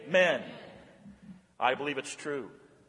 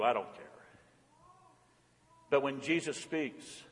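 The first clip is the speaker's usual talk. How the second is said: normal talking voice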